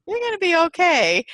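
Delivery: high, light cooing reassurance; cooing to a bird. Retold or imitated. Comforting the stunned little bird in hand, high gentle coo. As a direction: lift the voice up high to soothe a tiny creature.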